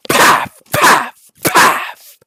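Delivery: loud, explosive puffs of breath; imitated punch sounds. Acting out a merciless beating, imitated punch sounds. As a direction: voice each blow as a hard smack. Imitates repeated blows landing.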